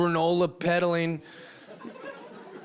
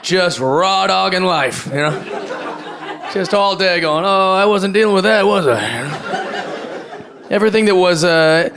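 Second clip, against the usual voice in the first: louder, pushed stand-up punch; loud delivery; 11 dB louder than usual. Real, not acted. Comic tells the crowd about sobriety, much louder. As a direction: belt the bit to the back row.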